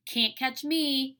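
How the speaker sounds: put-on toddler taunting in a slow singsong; hollered taunt. A narrator's reading. Little brother taunting the older kids, slow singsong. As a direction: tease like a little kid running off. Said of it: "he hollered".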